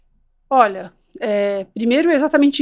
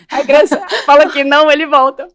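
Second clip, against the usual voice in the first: laughing through the words, louder; 3 semitones higher, 6 dB louder than usual. Retold or imitated. Mother retelling the parents' confusion, laughing. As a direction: chuckle while telling it.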